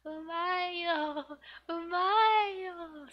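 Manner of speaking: high, sing-song "Umayo, umayo"; high playful kid praise; cute girl voice. Acted. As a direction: coo it like a cheerful little girl. Reads manga girl's praise.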